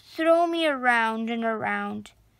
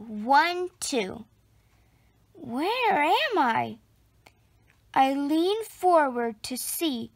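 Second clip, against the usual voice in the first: usual speaking voice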